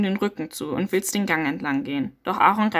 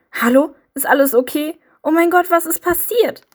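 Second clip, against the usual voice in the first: high, loud frightened cries over a body; panicked calling out; 8 semitones higher, 7 dB louder than usual. Acted. Finding a bloody body in the woods, panicked higher louder calls. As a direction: call out in shock and fear. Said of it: "Du schreist laut auf".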